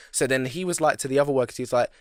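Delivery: ordinary talk, nothing marked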